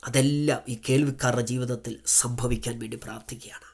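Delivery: whispered prayer; whispered intercession. Acted. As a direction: sink into a reverent whisper. Prayer line hushed.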